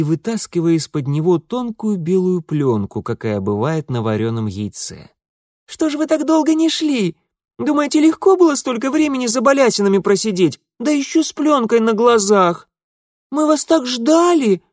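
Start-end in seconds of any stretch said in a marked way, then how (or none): 5.7-14.6 put-on high, loud eager child; heartfelt girlish complaint; little girl voice. A narrator's reading. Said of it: "с чувством прибавила первая". (горячий детский голос)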